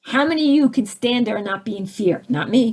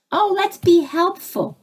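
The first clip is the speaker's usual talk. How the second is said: mock helpful; 7 semitones higher than usual. Mocking what we were taught.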